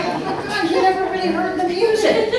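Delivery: high, loud, laughing disbelief; shocked kid, laughing at the dismay; devastated dismay. Retold or imitated. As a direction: gasp it out, half laughing. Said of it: "I'm like".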